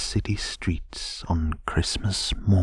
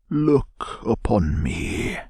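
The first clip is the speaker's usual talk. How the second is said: slow, higher, grand spirit voice; commanding ghost; 4 semitones higher, slower than usual. A narrator's reading. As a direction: command him to look, slowly. Ghost speaks, voiced by narrator.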